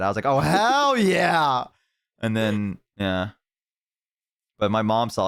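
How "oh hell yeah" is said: high, drawn-out gleeful cheer; imitating a kid's long excited yell. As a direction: whoop it like a thrilled kid. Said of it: "that's what I said, too, as a kid".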